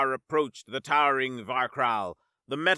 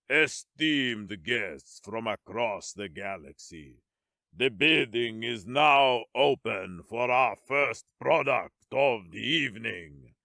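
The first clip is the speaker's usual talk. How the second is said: low, slow, showman auctioneer villain; grand villain announcer; 4 semitones lower than usual. A narrator's reading. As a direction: address the galaxy grandly. Villain auctioneer addressing guests.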